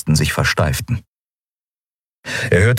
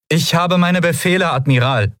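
much higher, formal officer's voice; female lieutenant voice; 11 semitones higher than usual. Acted. Lieutenant announcing herself to the admiral, in a high formal officer voice.

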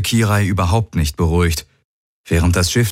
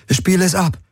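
short command, pitched up high; a touch of insistence in a higher voice; demanding; 12 semitones higher than usual. A narrator's reading. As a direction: give the order urgently. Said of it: "forderte Kirai".

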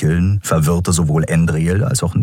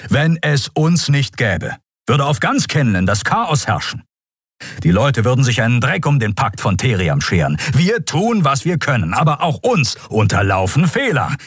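higher-pitched, emphatic justification; heated self-defence; 7 semitones higher than usual. A narrator's reading. Admiral defending his force to the prisoner, heated. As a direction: defend your people with some heat.

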